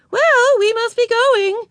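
high, slow, loud lady's voice. A narrator's reading. Mrs Whistler taking leave of the girls, high and slow. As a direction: sing it out like a cheerful grown-up lady.

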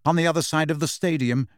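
usual speaking voice

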